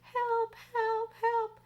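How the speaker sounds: high, far-off cries for help; grandmother calling. Retold or imitated. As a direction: cry out like the stuck grandmother. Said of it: "we could hear her calling to us".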